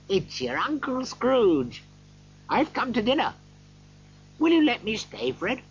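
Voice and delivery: high-pitched uncle's plea; hopeful Scrooge. A narrator's reading. Scrooge asking his nephew for dinner, hopeful.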